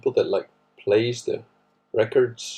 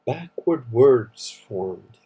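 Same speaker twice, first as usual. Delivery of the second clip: slow, puzzled; much slower than usual. Real, not acted. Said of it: "repeating phrase slowly".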